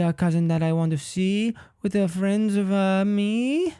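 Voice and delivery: put-on high, drawn-out caricatured Chinese accent; badly faked Chinese accent, halting and sing-song; mock Chinese accent. Comedian attempting an accent he says he can't do, mock Chinese accent. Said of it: "a Chinese accent is so stereotypical".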